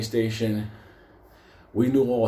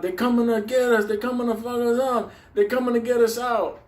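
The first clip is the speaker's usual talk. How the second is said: high, loud panicked yelling; screaming out; 13 semitones higher, 3 dB louder than usual. Retold or imitated. Someone in the tunnel screaming a warning in panic. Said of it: "somebody screaming out".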